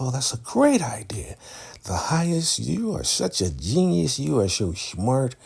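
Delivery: high, whispery hammed-up sucking up; mock fawning flattery. Followers flatter the highest about his idea, fawning. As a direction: gush over the leader's idea in a high mock voice.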